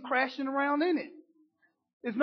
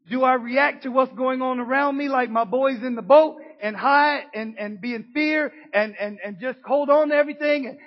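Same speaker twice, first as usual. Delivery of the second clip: loud, driving sermon build; preaching at full force; 8 dB louder than usual. Acted. Preacher pressing the congregation toward faith, loud and driving. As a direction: preach it out to the church with fire.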